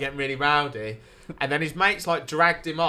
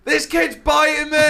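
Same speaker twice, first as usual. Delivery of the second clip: high, loud mock yell; retold panicked yell; 12 semitones higher, 8 dB louder than usual. In the middle of Burley, yelling that the kid's biting him, high panicked shout. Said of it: "just going".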